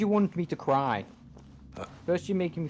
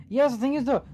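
raised frustrated voice; 10 semitones higher, 5 dB louder than usual. Real, not acted. Frustrated at game.

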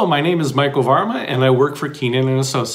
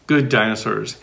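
usual speaking voice

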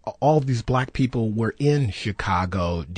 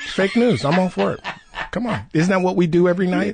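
laughing sarcasm; 9 semitones higher, 4 dB louder, faster than usual. Real, not acted.

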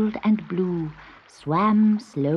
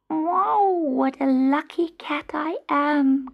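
high, meowed kitten voice; delighted kitten; 8 semitones higher, slower than usual. A narrator's reading. Kitten finds the kitchen he dreamed of, high delighted voice. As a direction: marvel in a small high cat voice.

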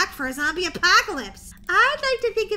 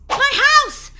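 much higher and louder cry of joy; relieved shout; 10 semitones higher, 11 dB louder than usual. Acted. Girl fleeing zombies reaches her house, joyful shout. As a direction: burst out with relief.

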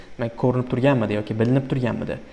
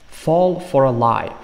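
slow, louder, careful reading; slow model phrase; 3 semitones higher, 6 dB louder, slower than usual. Shown as a demonstration. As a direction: set the phrase out slowly for students. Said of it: "Fall for a lie degani yolg'onga aldanmoq".

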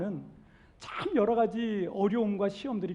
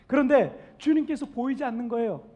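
ordinary delivery

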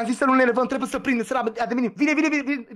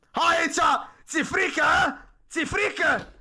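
taunting; 7 semitones higher, much slower than usual. Real, not acted. Taunting the monster to approach.

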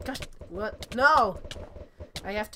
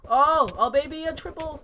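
excited shout; 6 semitones higher, 10 dB louder than usual. Acted. Hyping a triple kill, louder.

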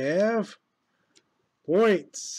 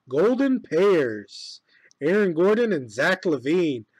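announcer voice; 7 dB louder than usual. Acted. Announces card pull like a broadcaster.

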